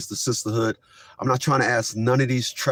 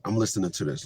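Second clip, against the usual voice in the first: ordinary talk, nothing marked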